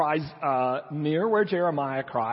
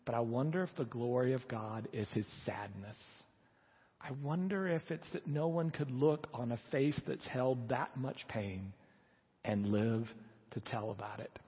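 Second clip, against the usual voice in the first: low, hushed, unhurried reflection; sombre wondering; 4 semitones lower, 8 dB quieter than usual. Acted. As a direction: let it sink almost to nothing, with pauses. Solemn climax of quote on sadness.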